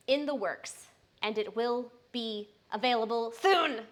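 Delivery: normal talking voice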